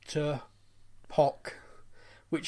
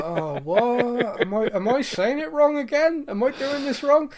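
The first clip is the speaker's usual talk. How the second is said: high, loud, exasperated protest; hammed-up confusion at being corrected; mock bewilderment; 6 semitones higher, 5 dB louder than usual. Acts out own confused protest.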